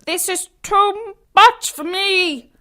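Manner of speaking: through the nose. A narrator's reading. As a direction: squeal it through a squashed nose. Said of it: "said through his nose".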